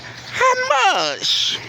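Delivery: high, light mock attendant voice; imitating attendant. Attendant asking him at the car window, high mock voice. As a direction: pitch it way up like the small attendant.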